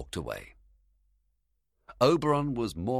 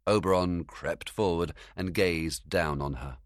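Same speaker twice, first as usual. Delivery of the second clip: plain reading voice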